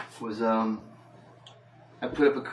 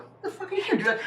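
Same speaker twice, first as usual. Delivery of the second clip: laughing disbelief. Retold or imitated. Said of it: "I'm like".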